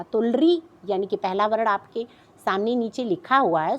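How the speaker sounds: ordinary delivery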